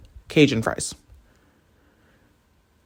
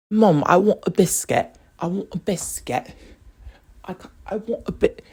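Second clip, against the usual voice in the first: higher-pitched little British boy, carefully put on; second, more careful try at a fake British accent; claymation British accent; 6 semitones higher than usual. British boy begging mom for a biscuit, claymation British accent. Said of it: "I can do a better accent than that".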